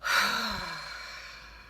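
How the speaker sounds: long, fading exhaled sigh; big releasing breath; falling-out sigh. Shown as a demonstration. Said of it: "exhale with a sigh".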